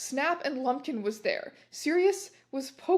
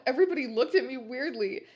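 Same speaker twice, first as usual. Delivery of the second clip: plain reading voice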